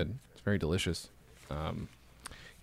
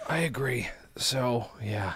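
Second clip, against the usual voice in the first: whispered aside; whispering; 5 dB quieter, slower than usual. Real, not acted. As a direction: whisper it under your breath. Sudden aside in quieter voice.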